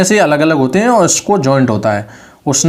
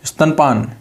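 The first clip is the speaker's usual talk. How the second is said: usual speaking voice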